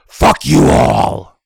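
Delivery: slow, loud harsh yell; harsh shout. Acted. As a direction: bellow it out with venom. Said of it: "That was harsh".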